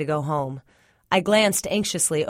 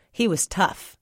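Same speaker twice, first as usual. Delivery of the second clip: plain reading voice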